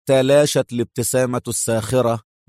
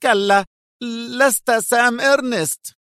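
Eitan, much higher, crying out; alarmed outcry; exclaiming; 10 semitones higher than usual. A narrator's reading. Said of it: "هتف إيتان".

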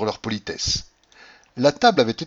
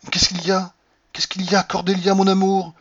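high, urgent questioning, growing louder; anxious husband calling to his wife, voice raised; alarmed; 9 semitones higher, 5 dB louder than usual. A narrator's reading. Husband alarmed as wife turns pale. (affolé, voix haute qui monte en force)

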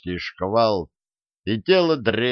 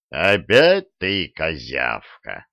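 growl; 5 semitones lower, slower than usual. A narrator's reading. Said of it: "прорычал Один".

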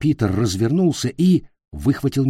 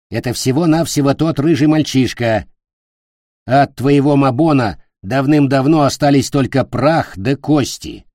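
louder, impatient witch; scolding witch; 4 semitones higher, 6 dB louder than usual. A narrator's reading. The witch corrects the владычица about the boy, scolding. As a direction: cut in as a scornful witch. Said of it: "вмешалась ведьма".

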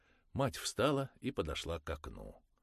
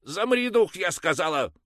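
much higher, loud barked order; angry shout; 15 dB louder than usual. Acted. Mother shouting at her son, angry and high. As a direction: snap him still. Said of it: "зло прикрикнула на него".

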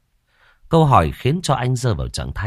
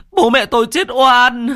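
even higher, louder anguished wail; sobbing wail, stronger; 7 dB louder than usual. Acted. Son repeating his cry for his parents, louder anguished wail. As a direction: push the grief further into a howl. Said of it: "mếu máo".

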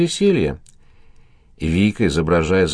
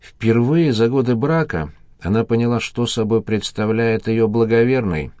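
usual speaking voice

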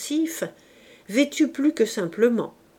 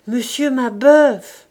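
put-on old woman calling louder, slowly; louder repeated call; 3 semitones higher, 10 dB louder, slower than usual. A narrator's reading. The old woman calls the silent old man louder. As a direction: call his name again, louder and drawn out. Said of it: "La vieille répéta".

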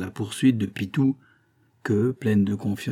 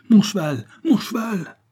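high, loud repeated call, climbing higher; urgent shouting; 10 semitones higher, 5 dB louder than usual. A narrator's reading. Farmer set on riding to Paris, shouting for his horse. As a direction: call out impatiently for it.

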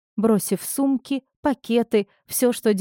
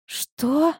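raspy whisper; 6 semitones higher than usual. A narrator's reading. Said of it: "прохрипела Дашка".

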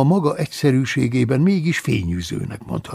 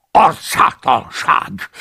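loud, slow indignant cry; furious outburst; 8 dB louder, slower than usual. Acted. The abbot rages at Paulus's insolence. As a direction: spit out the outrage. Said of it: "dühöngött".

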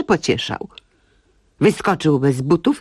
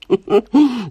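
quick amused laugh; short laugh; 7 semitones higher than usual. Acted. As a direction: laugh at your own joke. Laugh at own joke. (krótki śmiech)

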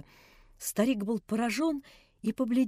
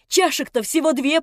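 higher, louder taunt; defiant taunt; 4 semitones higher, 7 dB louder than usual. A narrator's reading. Nelli taunting the narrator, defiant. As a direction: provoke him. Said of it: "прибавила она".

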